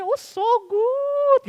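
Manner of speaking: very high, drawn-out fake admiration; mother's mock American gushing. Mother praising an awful drawing, high imitated American gush. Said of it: "Dengan nada kulit hitam".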